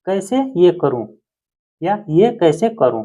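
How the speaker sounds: ordinary delivery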